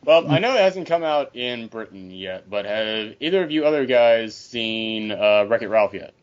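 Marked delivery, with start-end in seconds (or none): none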